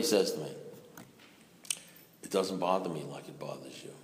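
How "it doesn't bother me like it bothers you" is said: low and much softer, unhurried; calm sponsor. Retold or imitated. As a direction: say it calmly, almost under the breath.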